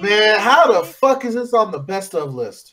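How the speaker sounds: high, drawn-out disbelief; slow, exasperated complaint; incredulous protest. Real, not acted. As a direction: object as if it's an outrage. Disputes the pick.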